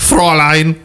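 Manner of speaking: high cartoon German accent; mock Nazi accent. Host finishing the villain bit, high mock German accent. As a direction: cap it off in hammed-up German. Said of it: "say that with a Nazi accent".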